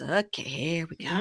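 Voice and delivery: soft imitation of weary dread; quiet, put-upon aside; resigned. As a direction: sigh it as the drama starts. Josh's resigned reaction, imitated.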